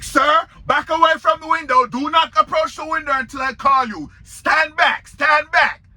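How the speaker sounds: retold clerk shouting stand back; bossy clerk orders. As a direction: bark it like a stern clerk. Clerk ordering him away.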